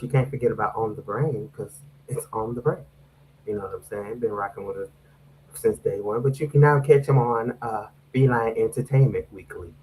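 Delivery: whispering. Acted. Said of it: "why you whispering like we in the nineteen seventies".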